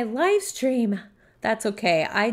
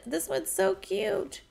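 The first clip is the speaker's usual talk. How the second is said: cooing over cuteness; 8 semitones higher, 5 dB quieter, slower than usual. Real, not acted. Cooing over toddler animation.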